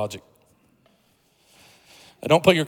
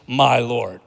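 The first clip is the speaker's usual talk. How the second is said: normal talking voice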